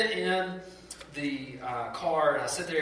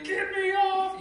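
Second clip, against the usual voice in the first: high, loud shriek of panic; retold panicked scream; 4 dB louder, slower than usual. Stuck on a spinning ride, begging to get off, shrieking high. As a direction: yelp it out like a scared kid on a ride. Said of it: "I'm screaming".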